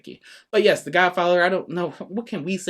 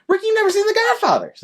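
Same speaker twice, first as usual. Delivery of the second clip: very high, incredulous outburst; loud disbelief; 4 dB louder than usual. Real, not acted. Scolding co-host for skipping The Godfather, shrieking disbelief. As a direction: cry out as if scandalized.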